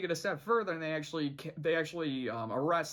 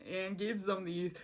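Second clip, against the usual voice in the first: ordinary talk, nothing marked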